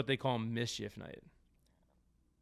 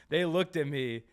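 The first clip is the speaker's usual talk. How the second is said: ordinary talk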